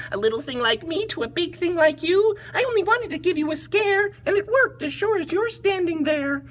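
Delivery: very high teasing mouse; high cheeky mouse; little mouse voice. Acted. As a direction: tease the big elephant as a tiny mouse. Mouse speaking.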